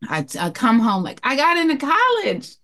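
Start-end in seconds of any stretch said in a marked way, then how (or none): 1.2-2.6 higher, eager announcement of good news; excited news. Retold or imitated. Daughter telling her mother about college, excited. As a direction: burst out with the news, a touch excited.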